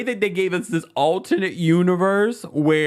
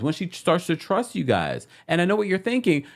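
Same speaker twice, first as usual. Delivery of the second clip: ordinary talk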